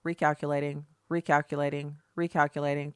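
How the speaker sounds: low, soft GPS voice mocking; robotic recalculating. Mocking confused liberals as a sat-nav voice. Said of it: "they're kind of like".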